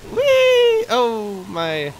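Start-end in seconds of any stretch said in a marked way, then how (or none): 0.1-0.9 very high, loud whee; long high "whee" of delight; gleeful squeal. Real, not acted. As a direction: squeal with joy on a wheelie. Doing a wheelie in game.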